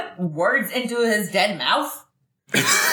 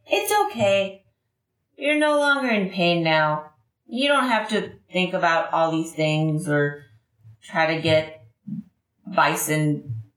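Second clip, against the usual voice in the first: gentle comfort; 6 semitones lower than usual. Acted. Mirka holding Jamtooth's head, gentle comfort. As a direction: soothe him as he slips away.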